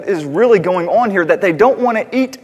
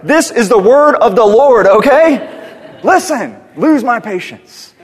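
louder, higher, stern scolding; mock-lecture an interrupting child; hammed-up impatient teacher; mock exasperation; 4 semitones higher, 8 dB louder, slower than usual. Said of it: "I wanted to be like".

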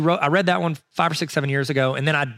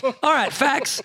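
high, lightly voiced know-it-all cohost; mock Neil impression; 11 semitones higher, much slower than usual. Teasing the cohost Neil, high mock voice. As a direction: rattle off facts as a mock cohost. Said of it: "Neil, fact, fact, fact".

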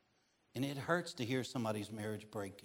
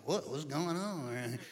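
slow, mock puzzled voice; young driver pretending his car broke down, mock puzzled; feigned confusion; slower than usual. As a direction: play dumb about the car. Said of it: "but I'm acting like".

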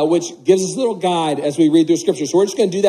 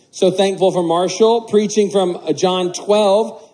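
usual speaking voice

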